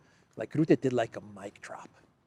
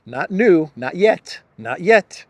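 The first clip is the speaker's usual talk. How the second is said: excited; 5 semitones higher, 11 dB louder, slower than usual. Real, not acted. Excited rant about Rutte.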